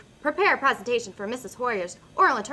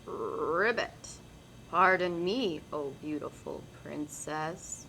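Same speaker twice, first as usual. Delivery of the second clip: softer and lower, pitiful plea; sad and pathetic; 5 semitones lower, 8 dB quieter, slower than usual. Acted. Frog pleading with the princess, soft pitiful sad voice. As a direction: sound as pitiful as possible. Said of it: "in his most sad and pathetic voice".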